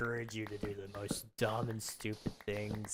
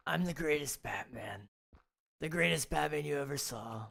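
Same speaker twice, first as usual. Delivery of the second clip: Donald Trump impression; 4 semitones higher than usual. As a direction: brag like Trump about being Batman. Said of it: "My Donald Trump voice".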